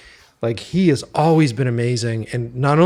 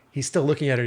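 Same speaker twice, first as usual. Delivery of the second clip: normal talking voice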